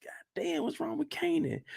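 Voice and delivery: soft, high aside; hushed concern. Acted. Onlooker wondering what's wrong with Kanan, hushed and high. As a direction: ask it under your breath, high and light.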